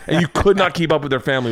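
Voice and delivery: normal talking voice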